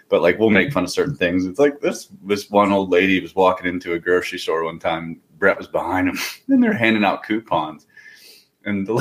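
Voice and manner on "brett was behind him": usual speaking voice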